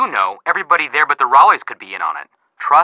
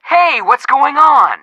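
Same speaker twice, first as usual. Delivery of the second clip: excited hey; 12 semitones higher, 5 dB louder, slower than usual. Acted. Eager greeting to Nancy.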